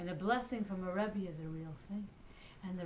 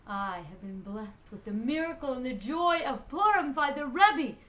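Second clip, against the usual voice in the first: joyful proclamation; 9 dB louder than usual. A narrator's reading. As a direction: proclaim it with rising fervour. Pinchas proclaims his blessing.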